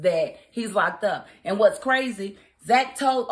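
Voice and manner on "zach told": usual speaking voice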